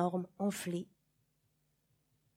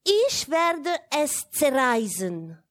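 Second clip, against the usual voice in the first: slow, louder and higher German declaration; loud German threat; 5 semitones higher, 6 dB louder, slower than usual. Acted. Child warning the governess, slow loud German vow. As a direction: proclaim it as a warning, drawn out. Child announcing the forbidden act.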